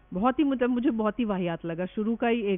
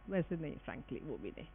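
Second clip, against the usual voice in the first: much softer, lower, under her breath; muttered aside; 8 semitones lower, 13 dB quieter than usual. Real, not acted. Teacher muttering an aside to herself, very soft. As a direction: drop it to a low mutter to herself.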